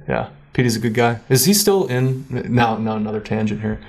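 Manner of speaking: normal talking voice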